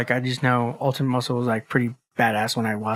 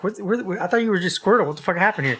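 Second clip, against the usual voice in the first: surprised; 6 semitones higher than usual. Retold or imitated. Shocked by Charizard switch.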